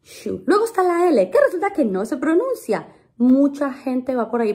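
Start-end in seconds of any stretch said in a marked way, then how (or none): 0.0-0.4 "Shoe" as a soft "shoo"; quiet, isolated "Shoe" with a pure oo; model pronunciation. Shown as a demonstration. Teacher likening the sound to the shoe word, model pronunciation. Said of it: "Como si shoe de zapato".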